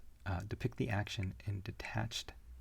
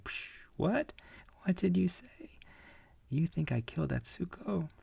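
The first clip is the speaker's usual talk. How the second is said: much higher, startled disbelief; a thrown man, stunned; a touch of shocked outrage; 9 semitones higher, 3 dB louder than usual. Acted. Thrown guy reacts, shocked.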